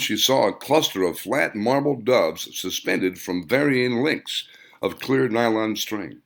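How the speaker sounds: plain reading voice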